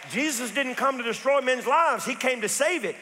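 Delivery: fervent sermon declaration; preaching it out. Real, not acted. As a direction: proclaim it to the whole room. Same line, now preached loud.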